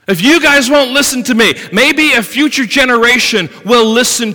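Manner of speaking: loud, driving sermon delivery; full-force preaching. Acted. Preacher drives home Job's growing faith, loud and rousing. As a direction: preach it with rising conviction.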